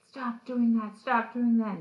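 loud, high mock confrontation; retold angry yell. Zach all up in Billy's grill, mock angry yell. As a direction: imitate someone yelling right in a face. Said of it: "getting all up in his grill".

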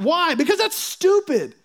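high, scoffing disbelief; incredulous. Real, not acted. Preacher scoffing at a silly habit, high and incredulous. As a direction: pitch it way up in disbelief.

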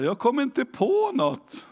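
high, whining mock excuse; whiny excuse. Preacher mocking a shy congregant, high whine. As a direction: mimic a congregant with nothing to say. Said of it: "Men ofta är det så här".

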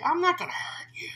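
an unvoiced, high, breathy whisper; hoarse whisper. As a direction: whisper it like the creepy parrot. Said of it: "it gets real hoarse and whispery".

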